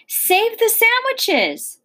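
high, drawn-out storybook yell; narrated yell. A narrator's reading. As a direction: call it out high and stretched. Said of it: "yelled to me".

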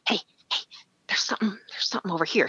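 fast, hushed urgent whisper. Retold or imitated. Jamie warning the guide from behind, fast urgent whisper. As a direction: hiss the warning while tapping him. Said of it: "she keeps tapping him".

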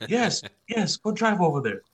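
high-pitched imitation of an insistent uncle; eager urging. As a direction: act out an uncle waving him on. Uncle urging boy to take car.

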